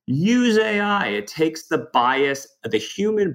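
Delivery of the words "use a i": higher, bright pitch voice; sales pitch voice. Retold or imitated. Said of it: "the sales pitch".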